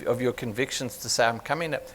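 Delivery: ordinary talk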